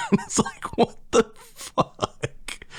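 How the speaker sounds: high, breathless laugh through the words; giggling in disbelief; laughing so hard it barely comes out. Real, not acted. Laughing at absurd video sequence.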